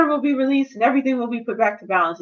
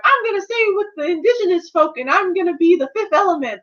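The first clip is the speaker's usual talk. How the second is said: high mock lofty declaration; 6 semitones higher than usual. Elsa's announcement.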